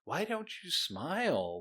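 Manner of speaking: high, slow, needling voice of a stranger; imitating people who nag her about her resting face; mock nagging stranger. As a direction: imitate someone nagging you to smile. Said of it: "Where it's like".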